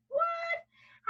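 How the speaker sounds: very high, squealed surprise; hammed-up, drawn-out squeal of disbelief; mock amazement. Teacher showing pupils a picture, mock-amazed squeal.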